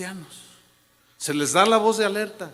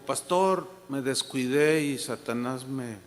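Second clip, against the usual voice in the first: retold broken confession; 7 semitones lower, 5 dB quieter than usual. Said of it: "me dijo".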